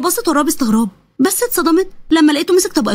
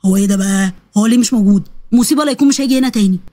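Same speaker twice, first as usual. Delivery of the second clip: lightly lowered, worried voice; dismayed young man; 6 semitones lower than usual. Acted. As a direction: fret mildly in a lower voice. (شاب قلقان بصوت أوطى شوية)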